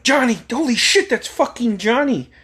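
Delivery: high-pitched retold cry of alarm. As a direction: re-enact the shocked discovery in a high voice. Retelling friends' alarm on finding him.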